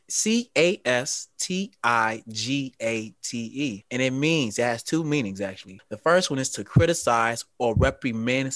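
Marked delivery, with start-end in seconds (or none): 0.1-3.8 slow spelled-out word; slow spelling. Shown as a demonstration. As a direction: spell it out letter by letter, slowly. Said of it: "spelling the word".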